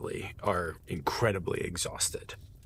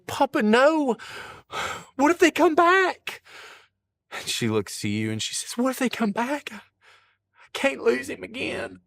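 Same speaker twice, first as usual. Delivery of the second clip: much higher, louder pleading; fearful old mama; 4 dB louder than usual. Acted. Old wife pleading not to lose her husband again, high. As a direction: near tears, begging. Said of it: "she goes".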